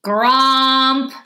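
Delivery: booming creature. A narrator's reading. Grumbly creature grumping at a child and mom, booming. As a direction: boom it out, long and held. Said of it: "the grumbly boomed again".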